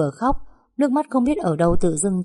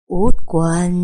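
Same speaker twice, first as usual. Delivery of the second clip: long eerie stretched call, loud; a ghost calling the girl's name from the dark, long and eerie; drawn-out ghostly call; 8 dB louder than usual. A narrator's reading. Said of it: "kéo dài thườn thượt".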